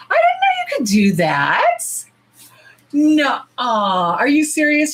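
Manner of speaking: stunned squeal of discovery; amazed. Real, not acted. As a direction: blurt it out in real disbelief. Learns she can edit lives.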